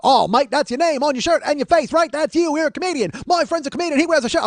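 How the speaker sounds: high, loud imitated Australian; Australian accent. Australian stranger accosting comedian over his shirt, loud accent. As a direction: pester him in an exaggerated Australian voice. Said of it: "my Australian or my Wookiee".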